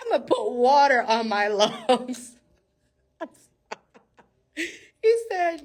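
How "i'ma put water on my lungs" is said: louder, laughing through a retold boast; real laughter in the words; cracking up. Real, not acted. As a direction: burst into laughter mid-quote. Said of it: "I'm trying to keep it professional".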